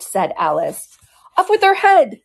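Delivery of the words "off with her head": much higher, louder shout; top of her voice. A narrator's reading. The queen ordering Alice's execution, shouting at the top of her voice. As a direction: scream the order at full volume. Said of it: "shouted at the top of her voice".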